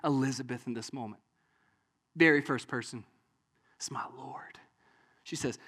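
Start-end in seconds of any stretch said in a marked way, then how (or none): 3.8-4.6 low, near-whispered reverence; awed confession. Acted. Elizabeth first calling Jesus Lord, hushed. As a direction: breathe it out in hushed awe.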